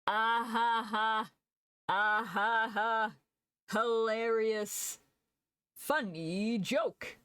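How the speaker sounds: slow, dry fake laugh; sarcastic slow-motion ha ha ha; mock Yoda deadpan. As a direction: drag out the flat laugh like an unimpressed Yoda. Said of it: "where Yoda goes, "Funny joke".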